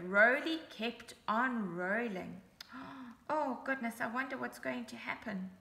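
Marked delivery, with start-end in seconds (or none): 2.5-3.2 quiet sharp mock gasp; dramatic storyteller gasp. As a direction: gasp for suspense. Storyteller gasps at danger.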